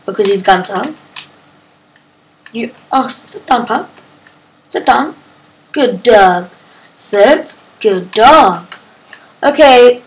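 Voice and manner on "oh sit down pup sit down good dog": usual speaking voice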